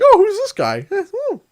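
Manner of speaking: high-pitched mock curiosity; playing dumb. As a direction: act innocently clueless, hammed up. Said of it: "he played it a little bit".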